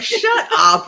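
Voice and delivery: loud, incredulous; shocked disbelief. Retold or imitated. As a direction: blurt it out in shock. Disbelief at celebrity call.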